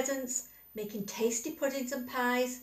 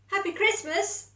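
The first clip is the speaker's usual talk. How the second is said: high, louder cheery call; cheerful shout; 9 semitones higher, 4 dB louder than usual. A narrator's reading. Squirrel calling up to Badger's window, high cheery shout. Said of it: "shouted Squirrel".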